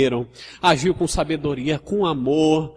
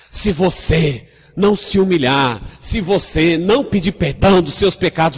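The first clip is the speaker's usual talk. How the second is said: louder, stern, threatening hellfire warning; harsh condemning preaching; 5 dB louder than usual. Shown as a demonstration. Preacher scolding a sinner with hellfire threats, loud and stern. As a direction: bark the warning like an accusing preacher. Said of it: "Nem eu tô acostumado a falar assim".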